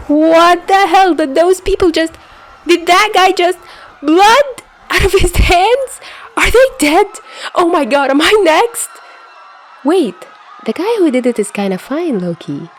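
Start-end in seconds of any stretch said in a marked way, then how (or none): 0.1-10.1 horrified shriek. Acted. Narrator witnessing a murder, shrieking in horror. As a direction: gasp out each horror.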